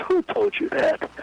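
sudden high, slow disbelief; a hammed-up 'who told you that?' with 'Who' jumping way up; incredulous Tommy. Tommy stunned by the claim.

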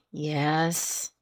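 low, soft, reluctant admission; quiet, grudging assent. A narrator's reading. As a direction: give up the answer unwillingly.

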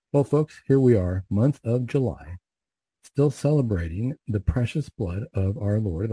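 ordinary talk

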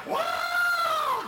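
piercing yell of pain; high squall. Retold or imitated. John squalling under the wildcat's attack. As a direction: cry out as the claws hit. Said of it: "John squall".

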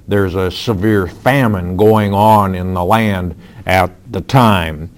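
ordinary talk

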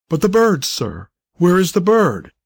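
mildly flustered demand; exclaiming. A narrator's reading. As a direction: exclaim it, pitch jumping up on the bird. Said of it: "exclaimed the doctor".

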